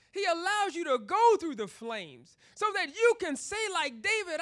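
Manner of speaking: high, loud, fervent preaching; preaching at full force. Acted. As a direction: build the sermon to a ringing climax.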